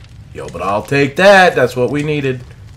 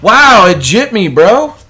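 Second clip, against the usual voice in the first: loud, slow, cheated outrage; outraged disbelief; 7 semitones higher, 8 dB louder, slower than usual. Real, not acted. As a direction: yell it like you got robbed. Loot vanished in game.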